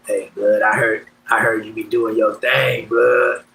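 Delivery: imitating a deep-voiced young homie from juvie. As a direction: drop way down into your old friend's deep voice. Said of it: "he got a deep ass voice when we was young".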